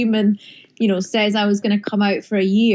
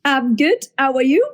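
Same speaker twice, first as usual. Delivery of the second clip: imitating a Geordie accent, higher pitched; accento Geordie imitato; 4 semitones higher than usual. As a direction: put on a playful Geordie lilt. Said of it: "That was me doing your accent".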